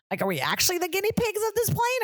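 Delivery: very high, alarmed question; high-voiced dawning panic, played for laughs; mock alarm. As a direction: squeak it up in comic worry. Acted alarm on new plane.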